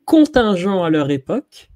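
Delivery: drumroll, then unveil contingents slowly; grand reveal. Shown as a demonstration. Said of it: "attention, je vais citer un mot, roulement de tambour".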